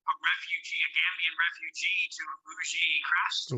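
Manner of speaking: distant, tinny bad connection; like a phone call from the moon; bad phone line. Real, not acted. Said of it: "you sound like you're on a phone on the moon".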